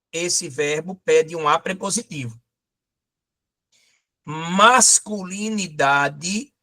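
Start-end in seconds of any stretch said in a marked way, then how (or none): 4.2-6.5 slow, stretched Masculinidade; drawn out slowly. Shown as a demonstration. Teacher stretching a word for the class, slow. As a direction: spell out Masculinidade syllable by syllable. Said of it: "é um substantivo feminino".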